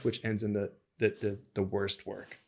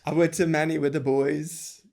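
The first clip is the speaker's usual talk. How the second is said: mock Manchester; 6 semitones higher, 5 dB louder than usual. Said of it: "I don't know what I could say about that".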